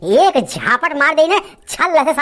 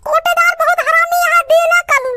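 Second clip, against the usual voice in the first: high, nagging village wife, scolding; wife scolding husband to fetch the free rations; put-on wife voice. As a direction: nag your lazy husband from up high in a woman's voice. Wife voice in sketch.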